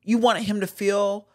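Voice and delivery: ordinary delivery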